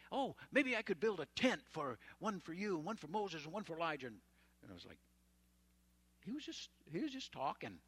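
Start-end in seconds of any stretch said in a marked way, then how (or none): 0.1-4.1 flustered Peter. Retold or imitated. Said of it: "he didn't know what to say".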